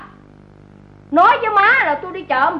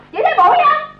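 sharper repeat; 8 semitones higher, 4 dB louder than usual. Acted.

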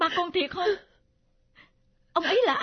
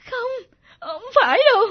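higher, louder sobbing protest; a daughter refusing to believe who her father is, sobbing; tearful denial; 6 semitones higher, 7 dB louder, faster than usual. Acted. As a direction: refuse it through tears, rushing. Daughter denies the news.

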